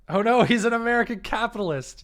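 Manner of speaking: high, loud mock dismay; hammed-up gasp of dismay; mock horror. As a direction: play up the fake outrage. Mock horror at villain's name.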